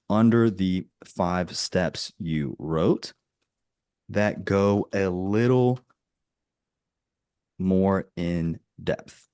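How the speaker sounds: halting, with long pauses between phrases; slow dictation. Real, not acted. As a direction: compose it aloud slowly while typing. Composing a prompt while typing.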